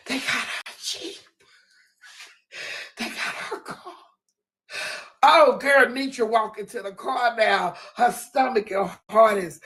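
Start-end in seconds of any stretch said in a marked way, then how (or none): 0.1-3.9 hushed crowd whisper. Retold or imitated. Onlookers whispering about her car. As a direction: breathe it like passing gossip. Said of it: "hear the whispers".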